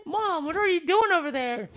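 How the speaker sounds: high put-on whiny kid; annoyed kid. Kevin answering his mom, high whiny kid voice.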